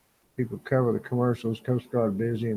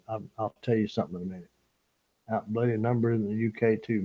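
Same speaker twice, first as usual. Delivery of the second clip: normal talking voice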